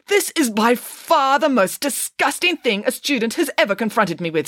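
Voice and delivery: high-pitched, affronted disgust, falling away; appalled. A narrator's reading. Teacher to student presenting poop evidence, appalled.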